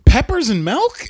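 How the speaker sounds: high-pitched rising question; baffled retold reaction; incredulous. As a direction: echo it in mock disbelief. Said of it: "you're just like".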